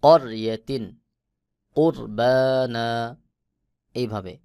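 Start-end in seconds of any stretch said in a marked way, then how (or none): 0.0-2.9 করিয়াতিন, কুরবানা with the র thick and heavy, কুরবানা drawn out slowly; র মোটা করে, ধীরে টেনে. Shown as a demonstration. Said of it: "রকে মোটা করে পড়তে হবে".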